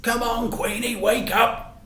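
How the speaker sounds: a fond owner coaxing his car. A narrator's reading. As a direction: gently coax the old car awake. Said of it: "He would say".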